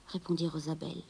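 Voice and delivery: plain reading voice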